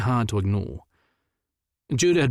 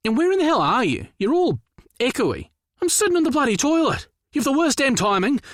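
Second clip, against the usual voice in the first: much higher, raised exasperated voice; yelling. Acted. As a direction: tell him off across the phone. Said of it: "a significant improvement on yelling at me".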